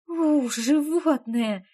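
slow, amused snort. A narrator's reading. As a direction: snort it out playfully. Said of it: "фыркнула я".

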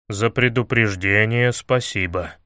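low, measured pronouncement; judicious ruling. A narrator's reading. Илья sentencing Алёна after her warning, low and judicious. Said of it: "рассудил Илья".

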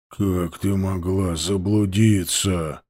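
low, slow, irritated; annoyed. Acted. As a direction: ask with annoyance. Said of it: "с досадой спросил Махнач".

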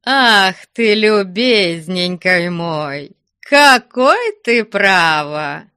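high, slow, singsong exclamation; effusive fond chiding. A narrator's reading. Abbot to his joking guest, gushing fond reproach. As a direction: coo at him in mock reproach.

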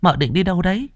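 high-pitched maid's voice, startled; startled young maid. A narrator's reading. Startled maid asking her mistress where she is going, high-pitched. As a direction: ask it high, caught by surprise.

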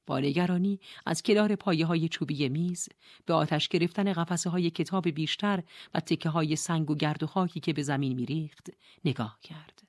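ordinary delivery, nothing marked

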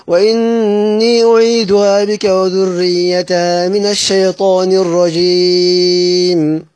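slow, drawn-out chanted tajweed recitation of the verse for Yaqub's reading; measured Quranic chant with open vowels. Shown as a demonstration. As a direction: recite it slowly and melodically, stretching the long vowels.

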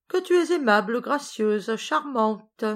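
high, sarcastic compliment; mock sweetness. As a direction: pile on the praise with sarcasm. Sarcastic praise in a quarrel.

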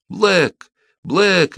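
high, desperate call to a dog. A narrator's reading. Queen desperately calling back her disobedient dog. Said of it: "отчаянно взывала королева".

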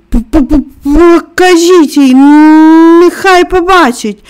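high, stammering plaintive plea; pitiful. A narrator's reading. Sobbing Colin letting them show Mary his back, pitifully stammered. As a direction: stutter into a drawn-out, pitiful wail. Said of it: "вимовив жалісливо".

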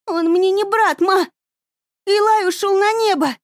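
high and loud, defiant; angry outburst. A narrator's reading. Daughter shouts back at her mother, angry and high.

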